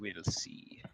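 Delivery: whispered, ominous promise. Acted. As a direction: breathe it out like a threat. DM ominous aside.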